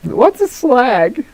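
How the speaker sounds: very high, slow, drawn-out mock question; baffled foreigner impression. Mimicking an American baffled by British slang, mock cluelessness. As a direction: play a clueless customer puzzling over "slag".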